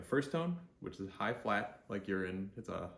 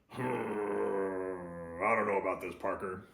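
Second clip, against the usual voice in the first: grumpy editor, long loud grumble; grumbling Jonah Jameson; 5 dB louder, slower than usual. Acted. Editor doubts Parker, leaning back with a grumpy grumble. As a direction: grumble slowly, drawing out the "hmm". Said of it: "the grumpy guy".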